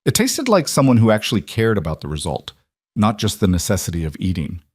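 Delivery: ordinary delivery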